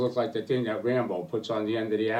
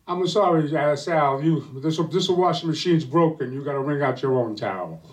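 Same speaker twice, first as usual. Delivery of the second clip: pitched up and louder, a sassy wife turning down her husband; 5 semitones higher, 8 dB louder than usual. Acted. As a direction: play the wife high, loud and mocking. Aunt Rosie refuses her husband.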